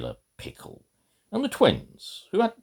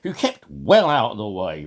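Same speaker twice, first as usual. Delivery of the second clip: ordinary delivery, nothing marked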